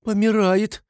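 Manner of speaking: high-pitched cry from the crowd; shouting. A narrator's reading. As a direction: call out the alarm in a high voice. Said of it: "закричал кто-то". (крик из толпы, высоким голосом)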